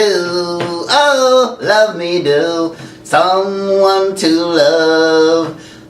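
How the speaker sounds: ordinary talk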